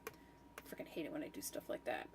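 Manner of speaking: real annoyance under the breath; frustrated mutter. Real, not acted. Annoyed at her own mistake.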